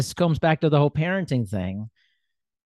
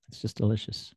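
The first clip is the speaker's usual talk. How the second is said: soft and dreamy, much softer, savoring the word delicious; wistful; 4 semitones lower, 10 dB quieter than usual. Real, not acted. Musing to his guest about bourbon, softly wistful. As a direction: drift off fondly as if tasting it. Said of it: "really wistful then".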